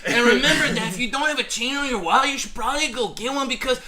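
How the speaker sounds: laughing hammed-up Mexican accent; joking Mexican accent. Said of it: "the whole time I did that accent".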